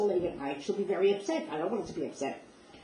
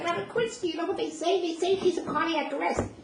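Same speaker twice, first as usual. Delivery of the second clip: high, breathless words with a nervous laugh; panicked; 11 semitones higher than usual. Retold or imitated. Banging on the secretary's car window, panicked and high. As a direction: blurt the news in a frantic rush.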